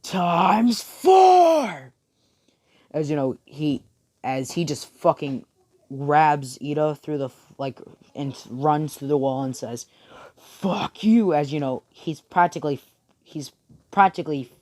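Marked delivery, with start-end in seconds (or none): 0.0-1.9 high, loud attack call; full-force anime power-up yell; battle scream. Acted. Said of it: "he screams". 10.4-11.3 raised, higher fighting yell; acted furious outburst; angry shout. Acted attack on a rival.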